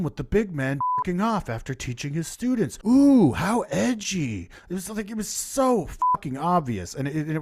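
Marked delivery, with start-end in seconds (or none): 2.9-4.5 slow, high, louder mock awe; sarcastic. Real, not acted. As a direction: drag it out, dripping with sarcasm. Sarcastic reaction to opening scene.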